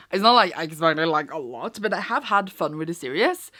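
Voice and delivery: ordinary delivery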